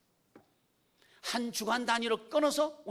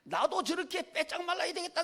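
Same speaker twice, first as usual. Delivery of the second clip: a hint of a wistful teenager, slightly higher; mock teen voice; 9 semitones higher, 3 dB louder than usual. Preacher imitates young people wanting to be thin, lightly voiced. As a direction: lightly voice a kid wanting to be skinny. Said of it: "우리 아이들이 말이죠".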